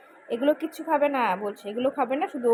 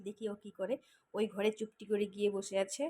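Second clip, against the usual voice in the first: ordinary talk, nothing marked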